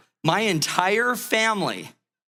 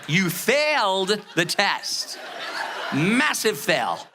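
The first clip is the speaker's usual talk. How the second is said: slow teasing gloat; much slower than usual. Retold or imitated. Teasing wife, laughing.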